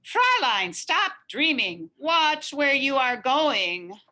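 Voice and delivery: angry carriage driver yelling. A narrator's reading. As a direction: yell at the daydreaming girl. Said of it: "were yelling".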